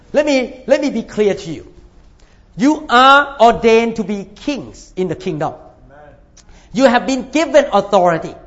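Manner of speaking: ordinary talk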